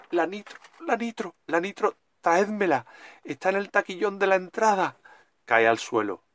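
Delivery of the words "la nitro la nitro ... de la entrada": high, urgent panicked begging; panicked pleading. Acted. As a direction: beg for the pills in panic. Character begs for heart pills.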